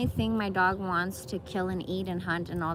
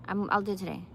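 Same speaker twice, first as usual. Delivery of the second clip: ordinary talk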